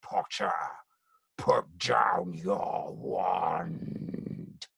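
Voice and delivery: menacing whisper, very slow; Death Eater. Acted. Death Eater threatening Potter in the game, slow sinister whisper. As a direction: hiss the threat slowly like a dark wizard. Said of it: "my favorite line was".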